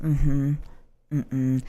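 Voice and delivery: low, quiet, toneless grunts; flatlined response. Acted. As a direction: act out someone barely responding. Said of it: "to see a flat line".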